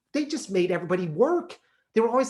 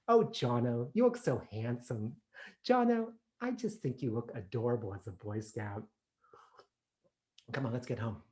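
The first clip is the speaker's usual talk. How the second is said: soft doting mother; 3 semitones lower, 6 dB quieter than usual. Retold or imitated. Mother praising son.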